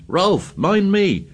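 high, frightened scream; a scared boy screaming a warning to his friend. A narrator's reading. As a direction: cry out in panic. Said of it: "Piggy screamed".

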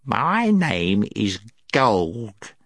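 slow, put-on gnome voice; drawn-out creature voice; gnome storyteller. A narrator's reading. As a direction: introduce yourself slowly as a gnome.

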